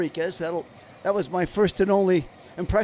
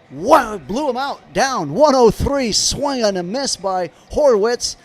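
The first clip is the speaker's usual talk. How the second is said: excited call; 4 semitones higher, 6 dB louder than usual. Real, not acted. Strikeout on 103 mph fastball.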